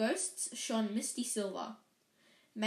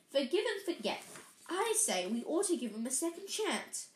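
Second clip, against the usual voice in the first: high, kindly monk voice; a plump, gentle friar pleading mercy; fat friar voice; 4 semitones higher than usual. Acted. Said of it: "What looked like a fat little monk was saying".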